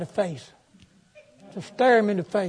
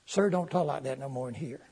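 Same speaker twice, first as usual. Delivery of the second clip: quiet pleading; 4 semitones lower, 9 dB quieter than usual. Retold or imitated. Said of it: "I said".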